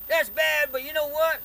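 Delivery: high, very loud heckler's yell; mock a stranger's full-volume heckle; imitated yelling. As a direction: holler up at a painter on a ladder.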